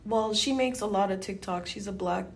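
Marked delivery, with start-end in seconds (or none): none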